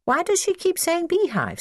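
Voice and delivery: put-on high-pitched child's question; high, puzzled little voice. A narrator's reading. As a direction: ask it high, like a small boy.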